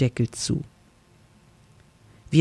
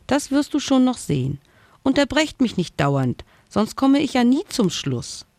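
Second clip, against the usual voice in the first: higher, mildly put-out little ghost; 8 semitones higher than usual. Acted. Little ghost tells his friends to stop interrupting, mildly annoyed. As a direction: gently shoo away the interruptions.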